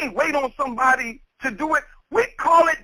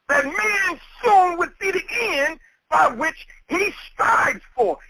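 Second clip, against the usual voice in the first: slow quoted scripture; 5 semitones higher, slower than usual. Acted. Preacher quotes the Quran verse.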